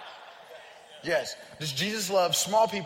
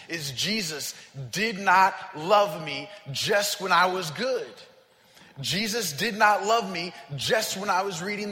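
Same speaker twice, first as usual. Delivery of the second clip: louder, mounting sermon cadence; building preaching; 2 semitones higher, 4 dB louder than usual. Acted. Pastor confessing to his congregation that Jesus loved him at his worst, building preaching.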